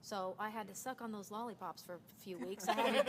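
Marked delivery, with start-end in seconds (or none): 2.7-3.1 words breaking into a high laugh. Real, not acted. Telling the host about sucking lollipops, laughing. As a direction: laugh right through it.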